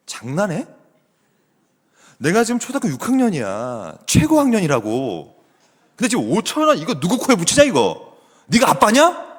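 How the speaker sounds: a spoiled sixth-grader working herself up into outrage; bratty sulking daughter. Retold or imitated. Daughter scorning dad's five-thousand-won gift, building from sulky mutter to a loud rant. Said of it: "제 딸이 이렇게 했다고 생각해봐요".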